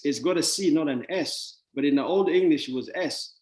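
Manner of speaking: normal talking voice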